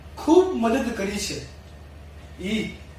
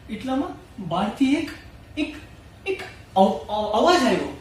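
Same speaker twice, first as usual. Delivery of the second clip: normal talking voice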